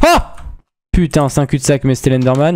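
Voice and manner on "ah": startled high yelp; scared horror game streamer. Real, not acted. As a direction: jump at the Enderman. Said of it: "il m'a fait peur".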